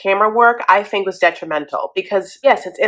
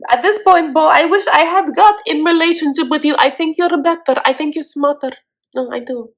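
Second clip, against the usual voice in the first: mock Natalie; 8 semitones higher than usual. Host as Natalie dumping Bo, high and dramatic. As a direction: wave him off like a soap diva.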